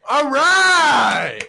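loud, high shout. Real, not acted. Loud outburst in song chaos.

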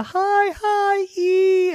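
squeaky-high held note; high piano note. Acted. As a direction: leap way up like a top piano key.

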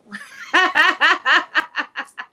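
a big, high burst of laughter; loud high laugh. Real, not acted. Host laughing at the kinky people joke, loud high laugh. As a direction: crack up loudly at the joke.

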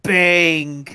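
imitating Spike. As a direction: toss it off like Spike. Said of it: "say the famous Spike line".

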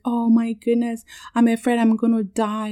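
high-pitched mock panic; retold tearful panic. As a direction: retell a frightened cry to dad. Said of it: "going off and just crying".

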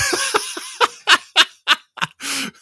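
loud, high burst of laughter; laughing. Real, not acted. Cracking up at own attempted accent, laughing. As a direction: crack up helplessly.

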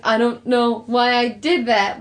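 slow, halting mind-controlled voice; against his will. Retold or imitated. Imitating the mind-controlled boy, slow and reluctant. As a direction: say it slowly, as if compelled. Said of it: "against his will sort of body motion".

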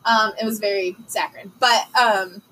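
ordinary delivery

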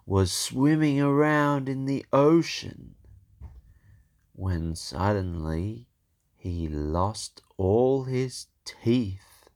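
usual speaking voice

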